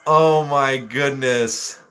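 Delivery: normal talking voice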